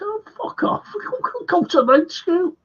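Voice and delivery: very high-pitched retold brush-off; mock dismissal. Telling would-be Italian pupils to go away, high mock exasperation. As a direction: act out waving them away, voice far up high.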